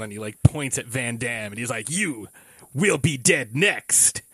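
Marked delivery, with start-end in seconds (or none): 1.9-4.2 slow, emphatic dubbed villain threat; Bolo Young. Retold or imitated. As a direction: imitate a badly dubbed kung fu heavy, word by word. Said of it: "Bolo Young where his voice is dubbed".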